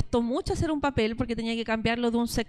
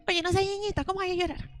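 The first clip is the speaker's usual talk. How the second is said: higher-pitched, put-on chiding; mock scolding adult; 5 semitones higher, faster than usual. Adult tells a crying boy not to be girly, mock scolding. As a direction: imitate someone shaming a child in a higher voice. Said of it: "cómo le dicen a los niños".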